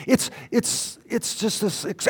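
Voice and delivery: usual speaking voice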